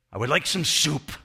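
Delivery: put-on comic, emphatic Hebrew-accented request; thick Hebrew accent. Elderly man answers visitor's question, thick Hebrew accent. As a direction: state the demand firmly in a put-on Hebrew accent. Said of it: "in a very thick Hebrew accent".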